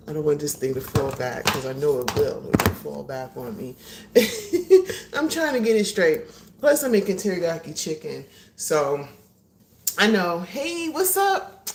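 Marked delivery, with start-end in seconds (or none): 3.8-5.1 loud high laugh. Real, not acted. Laughing while fixing the camera. 10.4-11.7 high, slow, sing-song hello; high greeting. Real, not acted. Greeting viewers joining the live.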